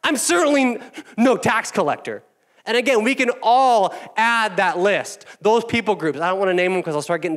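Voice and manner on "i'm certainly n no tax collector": high, loud sneering boast; smug Pharisee. Acted. Pastor voicing the Pharisee pointing out the tax collector, high and smug. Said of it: "he points someone out".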